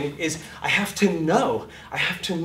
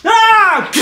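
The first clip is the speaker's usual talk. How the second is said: high, loud scream; actor's scream; 15 dB louder than usual. Shown as a demonstration. Overacting actor screams on action, high and loud. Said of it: "And I go".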